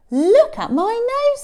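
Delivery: high, loud exclamation; amazed elephant. Acted. Elephant to the animals about his new nose, amazed. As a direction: show it off in wonder.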